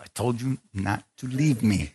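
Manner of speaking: measured, held-down mock reproach; restrained scolding. Wife catching husband up front, restrained scolding. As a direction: imitate a wife scolding under control.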